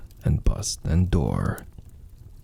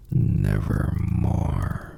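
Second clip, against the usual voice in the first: low, slow, ominous croak; raven voice; 9 semitones lower, much slower than usual. Acted. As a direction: drag it out like a dark bird. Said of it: "Quoth the raven".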